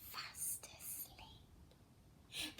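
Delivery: hushed whisper, very slow; breathy storybook hush. Acted. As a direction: whisper so you won't wake the lizard. Lizard sleeping, don't wake him.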